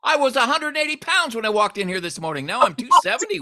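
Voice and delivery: hammed-up disbelief; playful pretend shock; mock shock.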